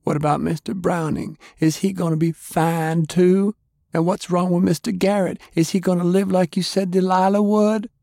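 put-on high, pressing girl's voice; Fay demanding answers from Lance in a high girl's voice; upset girl. A narrator's reading. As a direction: keep pushing him, near tears.

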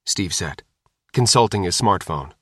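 plain reading voice

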